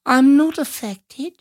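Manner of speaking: high, slow prissy voice; prim and proper. Retold or imitated. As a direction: pretend to be unaffected, primly. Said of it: "he's trying to pretend like".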